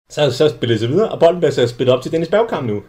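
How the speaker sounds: ordinary talk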